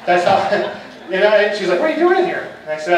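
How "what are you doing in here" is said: lightly voiced woman, pitched much higher; a startled colleague catching him in her office; surprised coworker. Coworker finds the coach hiding in her office, surprised high voice.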